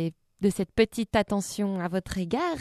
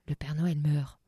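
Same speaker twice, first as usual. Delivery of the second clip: appalled aside; 8 semitones lower, 7 dB quieter than usual. Real, not acted.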